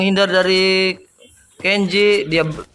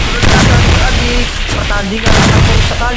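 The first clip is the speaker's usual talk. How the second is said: excited; 3 semitones higher, 5 dB louder than usual. Acted. Hyping the match to kids.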